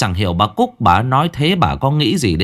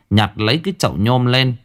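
usual speaking voice

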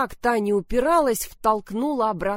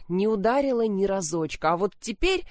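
usual speaking voice

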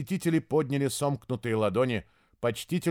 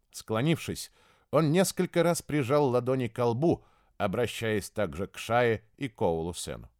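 usual speaking voice

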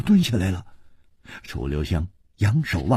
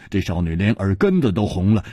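ordinary delivery, nothing marked

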